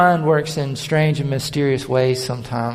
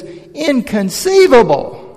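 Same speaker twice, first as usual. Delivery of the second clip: high, loud, drawn-out outraged cry; exasperated villain wailing his catchphrase; Vizzini; 9 semitones higher, 7 dB louder, slower than usual. Retold or imitated. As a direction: belt it out like an indignant little schemer. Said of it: "Vizzini is his name".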